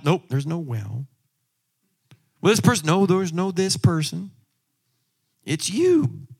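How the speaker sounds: ordinary talk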